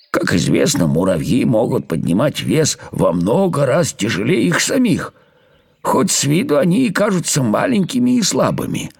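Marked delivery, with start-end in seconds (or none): none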